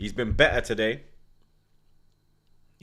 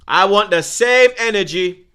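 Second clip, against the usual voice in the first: shouting; 7 semitones higher, 9 dB louder than usual. Real, not acted. Ranting at pundits' double standards.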